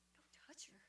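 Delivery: voiceless whisper; hushed warning. Retold or imitated. Woman warning a man beside the winner, whispered.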